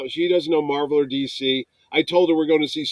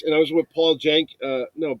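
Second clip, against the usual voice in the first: usual speaking voice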